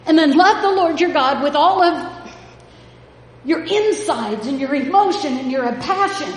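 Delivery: escalating pulpit cry; building preaching. Acted. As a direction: climb to the climax of the sermon. Preaching climax, rising intensity.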